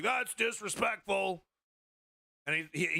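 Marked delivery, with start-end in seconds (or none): 0.0-1.4 much higher, slow, blunt wrestler-politician voice; Jesse Ventura impression. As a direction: call them out flat-out like a brash ex-wrestler.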